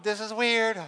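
high, loud mock awkward voice; awkward thought. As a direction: sell their discomfort. Imitating awkward person.

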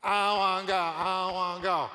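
loud, high mock tantrum; Moses. Moses refusing God's call, hammed-up protest. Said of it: "Moses said".

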